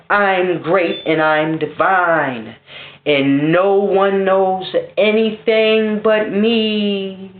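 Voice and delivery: very slow, drawn-out self-worship; grandiose rambling. Retold or imitated. Eligio rambling in the garden, slow grandiose drawl. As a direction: stretch each word like a dazed prophet. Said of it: "He's like".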